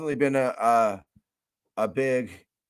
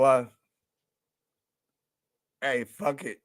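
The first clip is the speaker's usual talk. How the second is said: usual speaking voice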